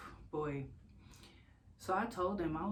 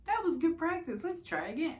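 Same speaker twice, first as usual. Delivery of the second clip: mock cheerful nurse; 13 semitones higher, 5 dB louder than usual. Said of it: "or if it was just like".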